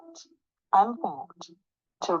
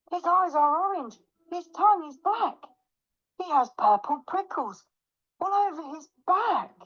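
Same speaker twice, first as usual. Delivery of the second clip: high, anxious description; high little voice of dread; alarmed mouse; 8 semitones higher than usual. Acted. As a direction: pitch it up in frightened recognition. Mouse sees the real monster.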